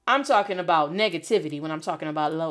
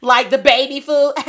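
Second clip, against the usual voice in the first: loud, higher mocking jokester with laughs; class clown; 4 semitones higher, 8 dB louder than usual. Class clown teasing a student's name, loud mock laughing. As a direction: imitate a heckling clown cracking himself up. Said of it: "some class clown was like".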